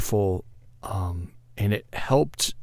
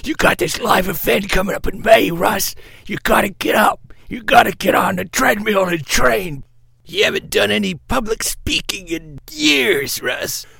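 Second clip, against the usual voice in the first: put-on much higher old-trainer voice, louder; Mickey from Rocky; 11 semitones higher, 5 dB louder than usual. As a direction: drive yourself like a gruff coach. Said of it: "a coach in my head that sounds like Mickey".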